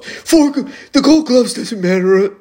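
high, loud sneering parody of a know-it-all; mocking critic voice. Mocking a critic's dismissive take.

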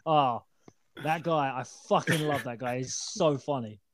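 normal talking voice

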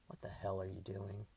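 low, soft grumbling man; male muttering. A narrator's reading. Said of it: "a male voice muttered".

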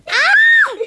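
loud, high startled shriek; high-pitched yelp of alarm; startled scream. Real, not acted. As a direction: shriek as if suddenly attacked. Attacked with a wig.

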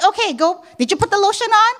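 high, loud rushing call; hurried call. Retold or imitated. Mother hurrying her kids out the door, high and loud.

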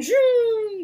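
high, loud, drawn-out zoom; long falling "zoom" sound effect; imitated zoom. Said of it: "Ganun siya".

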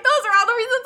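excited. Retold or imitated. Excited realization.

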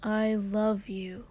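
ordinary delivery

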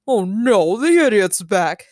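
slow, loud voice in the head; Khonshu. A narrator's reading. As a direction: groan it slowly as a scornful god. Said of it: "said Khonshu in Steven's head".

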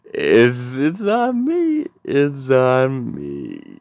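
put-on loud, high drunk insistence; drunk insisting. Drunk guy at the bar insisting he pays, loud and high. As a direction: slur it like a drunk grabbing the bill.

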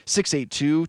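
normal talking voice

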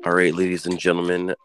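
ordinary delivery

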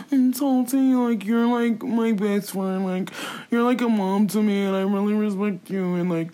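halting mock-tearful imitation; his apology voice. Mocking his apology to Tati, badly faked tears. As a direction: mimic an over-emotional, stumbling apology. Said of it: "this is literally how he talks".

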